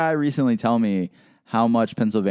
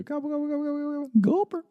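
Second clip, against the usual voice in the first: high-pitched rapid turkey gobbling; turkey gobble; faster than usual. Retold or imitated. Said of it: "And you just say".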